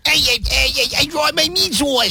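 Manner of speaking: squealy, very high caricature; exaggerated high voice with a bad accent; [name]'s Danielle impression. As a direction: do a drawn-out, high-pitched mockery of a coworker. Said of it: "that's how he thinks you sound".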